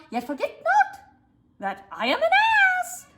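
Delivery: a small crescendo ending on ass; mini crescendo. Shown as a demonstration. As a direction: build up to ass, climbing high. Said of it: "mini crescendo".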